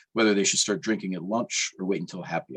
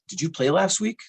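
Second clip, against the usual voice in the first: pitched way up, urgent question; urgent excitement; 9 semitones higher than usual. A narrator's reading. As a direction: press him, breathless with news. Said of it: "she says".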